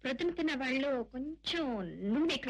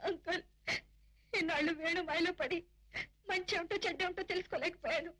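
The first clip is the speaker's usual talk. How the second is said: higher-pitched weeping complaint; tearful; 4 semitones higher than usual. Acted. Niece crying to uncle about the man who betrayed her, tearful. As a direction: sob it out to a trusted elder. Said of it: "ఎందుకమ్మా ఏడుస్తున్నావు".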